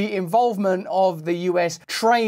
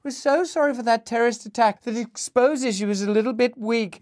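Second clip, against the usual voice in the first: higher, falsely sweet sarcastic sympathy; snide condolences; 7 semitones higher than usual. Pretend. Host mocking Western condolences to Russia, sarcastic fake sympathy. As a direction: fake sorry with a sneer. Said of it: "snidey bastard condolences".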